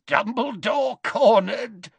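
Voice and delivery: high, slow gloating voice; gloating Death Eater. Acted. Amicus to his sister, gloating over Dumbledore. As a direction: savour every word of triumph. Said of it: "gave a wheezy giggle".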